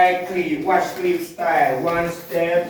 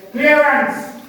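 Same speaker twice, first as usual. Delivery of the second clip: calling out; 9 semitones higher than usual. Real, not acted. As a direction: call it out to the group. Guide warning group in cave.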